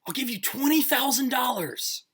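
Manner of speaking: high, loud desperate call; yelling after someone. Acted. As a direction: shout it after a customer walking out the door. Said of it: "he yells after you".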